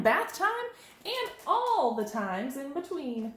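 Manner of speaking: measured storytime voice with lifted phrases; reading to children. A narrator's reading. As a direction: read to little ones, swinging the key words. Reads a picture book to children.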